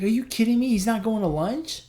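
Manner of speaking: high-pitched disbelieving question; incredulous. Retold or imitated. Runner learning his buddy skipped lunch, incredulous.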